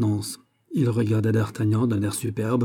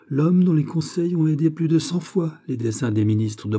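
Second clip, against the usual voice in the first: higher-pitched, ardent praise; with warm fervour; 6 semitones higher than usual. A narrator's reading. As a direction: sing a comrade's praises with heat. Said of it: "dit avec chaleur D'Artagnan". (avec chaleur, voix plus haute)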